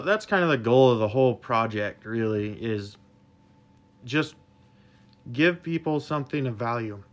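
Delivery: ordinary talk, nothing marked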